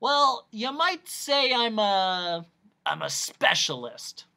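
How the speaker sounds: slow, high, stretched-out musing; eccentric noise doctor. Acted. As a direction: draw it out, then settle on the title.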